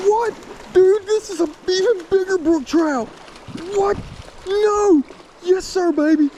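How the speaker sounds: high, excited yelps of disbelief; can't believe the catch, voice jumping high; thrilled disbelief. Real, not acted. Catching a bigger brook trout.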